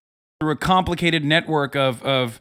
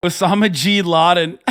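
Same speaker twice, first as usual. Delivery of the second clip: normal talking voice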